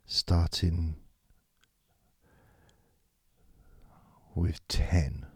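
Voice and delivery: soft, fading meditation countdown; guided meditation. Acted. Leads a countdown relaxation.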